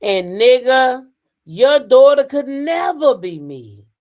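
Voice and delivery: slow, drawn-out, emphatic quote; reading her words out. Retold or imitated. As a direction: read out her post word by word, slowly. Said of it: "She said".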